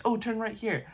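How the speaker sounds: high, chirpy mock sign voice. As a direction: squeak it out like a silly road sign. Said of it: "it was like".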